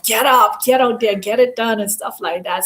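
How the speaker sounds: ordinary talk, nothing marked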